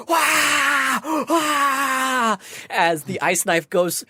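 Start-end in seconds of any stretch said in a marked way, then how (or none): 0.1-2.4 high, drawn-out crowd "wah"; wild audience cheer. Acted. Game master voicing the audience as it goes wild, mock crowd cheer. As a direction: imitate a crowd going wild. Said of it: "the audience just goes wild".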